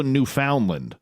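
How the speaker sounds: Newfoundland said as noo-FOUND-lund; alternative pronunciation. Shown as a demonstration. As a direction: say a full FOUND inside "Newfoundland". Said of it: "And, and there's also".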